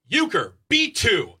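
high, slow, drawn-out recital; slow spoken reminder. Real, not acted. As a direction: spell it out slowly to remember it. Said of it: "I'll say it out loud like that".

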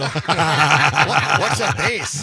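loud, laughing mock question; laughing echo. Host teasing the bassist about his boyhood question, laughing. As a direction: repeat it loudly through laughter.

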